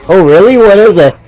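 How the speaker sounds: acted-out eager question; curious friend. Voices Marcus answering the kid.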